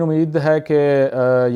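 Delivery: normal talking voice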